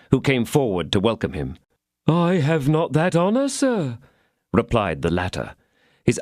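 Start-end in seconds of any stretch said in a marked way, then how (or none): 2.1-4.0 lightly raised innkeeper voice; a hint of a nervous host, pitched up. A narrator's reading. Landlord greeting an unknown guest, lightly voiced.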